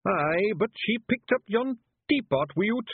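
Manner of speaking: high, slow Scottish pixie voice; blurting Feegle. A narrator's reading. Feegle objecting that she picked up the teapot, high-pitched blurting. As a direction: blurt it as a small Scots creature.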